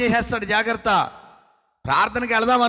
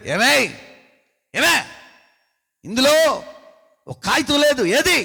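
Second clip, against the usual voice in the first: a husband's raised, higher demand, louder; husband loudly demanding the missing money; 5 semitones higher, 4 dB louder than usual. Retold or imitated. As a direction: confront the wife over the missing note.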